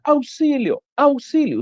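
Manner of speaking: hammed-up damsel calling help; mock princess in the castle calling for help; princess crying for help. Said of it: "comenzó a gritar".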